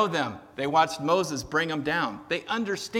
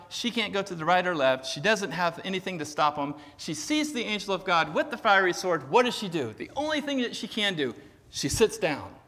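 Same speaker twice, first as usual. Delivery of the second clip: ordinary talk, nothing marked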